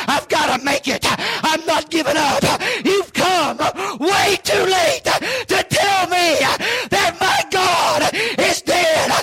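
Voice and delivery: impassioned preaching building to a high-pitched shout; fervent preaching. Real, not acted. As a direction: build the sermon up to a driving, gasping cry. Preaches at full force.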